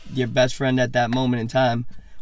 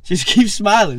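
higher and louder, proclaimed; loud, high proclaimed motto; loud mantra; 9 semitones higher, 9 dB louder than usual. Acted. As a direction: shout it like a battle cry. Declaring the mantra.

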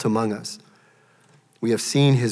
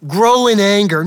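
scornful rebuke; 9 semitones higher, 12 dB louder than usual. Acted. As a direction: Grow in anger, loud, with a high fall on grow. No, none of that.

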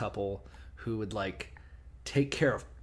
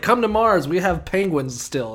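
loud high pitchman; ad announcer voice; 7 semitones higher, 9 dB louder than usual. Retold or imitated. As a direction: sell it like a cheesy ad. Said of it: "it's like".

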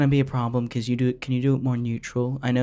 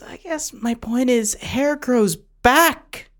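pleading; 11 semitones higher, 6 dB louder, much slower than usual. Pretend. Producer pleading about hair.

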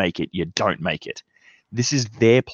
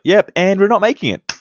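higher, louder, breezy mock Hasbro; mock company announcement; 10 semitones higher, 5 dB louder than usual. Host imagining Hasbro cancelling the project, breezy mock announcement. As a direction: toss it off like a careless corporate shrug. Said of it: "Just imagine if it was just like".

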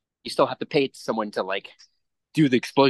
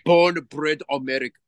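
Schwarzenegger impression. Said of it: "be like fucking Schwarzenegger".

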